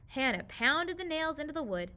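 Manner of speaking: usual speaking voice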